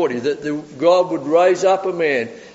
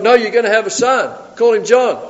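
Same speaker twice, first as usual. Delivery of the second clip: higher, firmly insistent retort; emphatic announcement; 7 semitones higher, 5 dB louder than usual. Retold or imitated. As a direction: announce it over his objections. Angel's firm announcement, acted.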